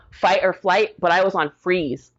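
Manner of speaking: ordinary delivery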